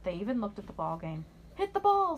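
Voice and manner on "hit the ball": Danny cheering. A narrator's reading. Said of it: "said Danny".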